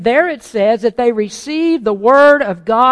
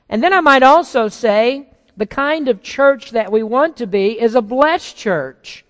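ordinary talk, nothing marked

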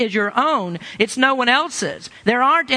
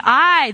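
I punched higher and louder; emphatic I; 4 semitones higher, 5 dB louder than usual. Shown as a demonstration. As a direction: hit I hard as the key word. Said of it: "there is emphatic".

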